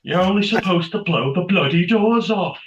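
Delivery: loud high Cockney film quote; Cockney accent. Retold or imitated. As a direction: belt out the famous line like Michael Caine. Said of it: "I'm not a Cockney".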